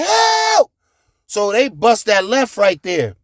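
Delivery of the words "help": high, loud single yell; yell for help. Retold or imitated. Kid yelling for help at a stoplight, high and loud. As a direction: shout it out at the red light. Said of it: "So I'm like".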